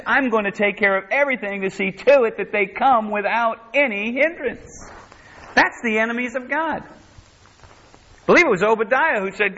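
high-pitched, driving sermon delivery; impassioned preaching. Real, not acted. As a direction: preach it with rising fervour, pitched well above talk.

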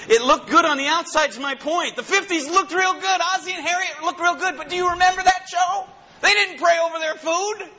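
high, ringing preacher voice; preaching at full force. Acted. Preacher driving home his point to the congregation, full force. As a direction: lift it up and preach it hard. Preaching climax on fifties image.

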